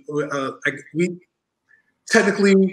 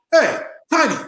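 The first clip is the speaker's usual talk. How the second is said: eager greeting; 11 semitones higher than usual. Retold or imitated. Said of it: "I go".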